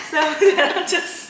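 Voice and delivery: slow, high and loud, laughing through it; picture yourself standing there and crack up. Real, not acted. Laughing at herself.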